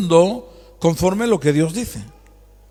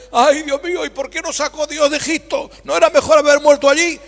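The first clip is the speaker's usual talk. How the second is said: higher, whining lament of the people; complaining Israelites; 7 semitones higher than usual. Retold or imitated. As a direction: moan it like the grumbling crowd. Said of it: "Y siempre la queja".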